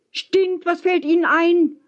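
high, indignant cry; empörter Ausruf. A narrator's reading. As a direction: throw the insult back in outrage. Indignant shouted retort to insult. (mit hoher Stimme empört rufen)

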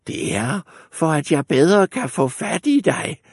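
wolf playing grandmother; wolf as granny. A narrator's reading. Wolf answers the girl, posing as granny.

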